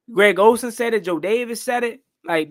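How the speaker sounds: usual speaking voice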